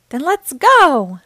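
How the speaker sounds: loud, high, drawn-out call; big stretched storybook holler; rousing shout. A narrator's reading. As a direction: shout it to rally the family. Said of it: "shouted Daddy Pig".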